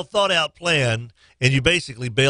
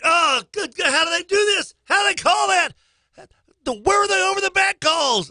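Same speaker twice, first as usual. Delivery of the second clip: much higher retold yelling; outraged yell. Host retells shouting at the TV over fouls, outraged high yell. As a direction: shout in disbelief at the refs. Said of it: "I was like".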